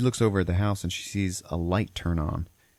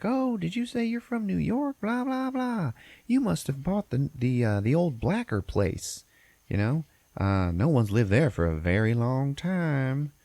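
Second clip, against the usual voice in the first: high put-on lady voice, drawing out her words; chatty local woman, hammed-up and high; shop lady; 8 semitones higher, slower than usual. Parlor lady greets New Yorkers about the old house, high chatty voice. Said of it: "the lady who runs it".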